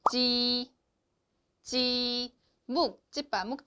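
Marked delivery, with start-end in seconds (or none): 0.1-2.3 지 with a clear tense j; Chinese j sound. Shown as a demonstration. Teacher models the Chinese j for students. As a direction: model 지 twice. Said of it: "J는요".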